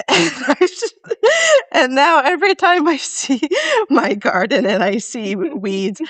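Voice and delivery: laughing. Real, not acted. Laughing at the priest memory.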